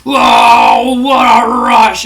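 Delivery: loud slow shouted "Whoa, what a rush"; Road Warriors catchphrase. Retold or imitated. Legion of Doom returns as the crowd pops, loud slow shout. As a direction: roar it out like a big entrance. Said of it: "All of a sudden, it's like".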